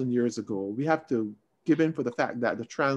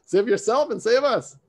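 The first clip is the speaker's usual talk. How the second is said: loud mocking jeer, pitched up; taunting; 7 semitones higher, 7 dB louder, much slower than usual. Thief on the cross taunting Jesus, loud mocking jeer. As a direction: sneer at him like a scoffing thief. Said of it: "started taunting him".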